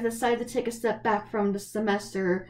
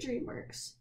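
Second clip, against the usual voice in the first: tearful whisper; 2 semitones higher, 12 dB quieter than usual. Real, not acted.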